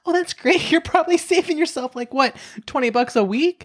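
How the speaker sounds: high cheery mock client; chirpy client voice. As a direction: play the naive upbeat client. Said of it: "I had a client who was like".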